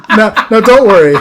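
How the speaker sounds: loud, high, through laughter; laughing while talking. Real, not acted. Storyteller reassuring listeners about [name], laughing loudly.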